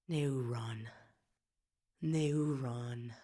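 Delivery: low, soft, under the breath; sigh, then murmur the name to yourself; brooding murmur. Acted. Brooding alone on enemy's name.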